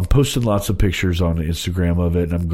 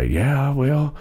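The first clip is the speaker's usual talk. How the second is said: high, fast imitated caller; old country guy voice; 11 semitones higher than usual. Retold caller voice.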